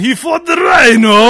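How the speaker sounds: slow, loud, shouted punchline; loud exclaim. Real, not acted. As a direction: belt out the punchline. Joking emphatic punchline.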